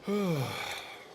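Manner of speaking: long, falling exhaled sigh. Real, not acted. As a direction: let a full breath out slowly. Sighs in a long silence.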